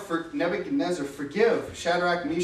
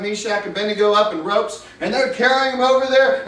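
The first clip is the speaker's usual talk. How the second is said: ordinary talk, nothing marked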